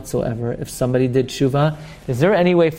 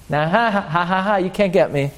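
sing-out taunt with a fake laugh; put-on "ha ha" of someone off the hook; mock gloating; 3 semitones higher than usual. Sinner escaping lashes taunts the court, mock gloating.

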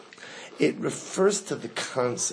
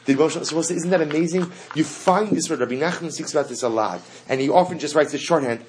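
normal talking voice

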